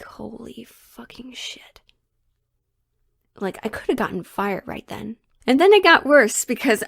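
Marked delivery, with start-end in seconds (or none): 0.0-1.8 slow, very soft whispered swearing; stunned whisper. Retold or imitated. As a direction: whisper it under your breath in shock. Stunned reaction after boss storms out.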